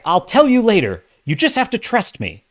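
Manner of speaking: mock the withholding character; rookie dialogue. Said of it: "It's like".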